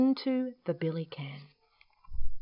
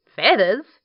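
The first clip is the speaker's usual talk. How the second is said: loud, higher smiling question; smiling query; 4 semitones higher, 10 dB louder than usual. Acted. Dingo answering Emu's offer, loud smiling question. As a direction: echo it loudly with a smile. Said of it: "smiled Dingo".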